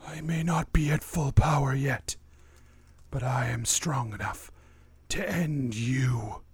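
rasping undead whisper; raspy whisper. Acted. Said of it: "his voice rasps".

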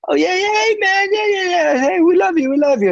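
very high, gushing mock salesman; squeaky-high impression of a two-faced dealer; fake friendliness. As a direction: overdo the phony buddy-buddy welcome. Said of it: "how I feel at these car dealerships".

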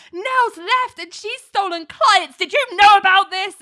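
high, loud, slowed accusation; frantic accusing shout. Retold or imitated. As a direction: grill her like a suspect, all alarm. Boss confronting assistant, panicking.

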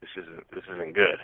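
quiet, low inner voice; inner thought. Retold or imitated. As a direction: think it to yourself, hushed and worried. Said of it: "In my head, I'm already thinking".

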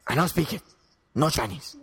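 Chinese accent. Shown as a demonstration. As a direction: put on a caricatured Chinese accent. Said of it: "Can you do a Chinese accent".

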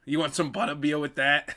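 playful Boston accent, dropped R's. Shown as a demonstration. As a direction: echo the offer in a Boston accent. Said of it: "how to do a Boston accent".

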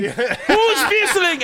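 put-on high, drawn-out German-accented demand; mock-outraged German actor; angry German accent. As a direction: stretch it out in a hammed-up German accent. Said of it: "especially in a German accent".

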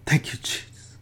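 breathy whisper, almost silent; whispered thanks. Real, not acted. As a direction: murmur a private thank-you under the breath. Aside of thanks mid sermon.

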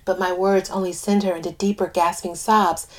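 plain reading voice

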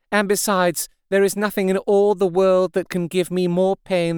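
lightly voiced brave despair; brave and sorrowful. A narrator's reading. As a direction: Mina telling the men to hide nothing. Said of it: "so brave, and yet so sorrowful".

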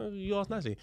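high, soft-edged retort; mock innocence. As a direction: play it innocently cheeky. Repeats his cheeky reply to sergeant.

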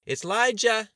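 put-on high-pitched, full-volume child's yell; shouting. A narrator's reading. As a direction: call it out loudly to someone in another room. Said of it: "she shouted as she walked".

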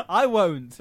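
refusing through loud high laughter; laughing. Real, not acted. Producer laughs off the request to edit the bit. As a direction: crack up while saying no. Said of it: "Edit that, [name]".

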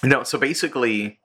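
usual speaking voice

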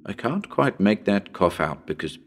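usual speaking voice

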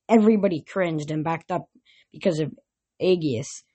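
ordinary delivery, nothing marked

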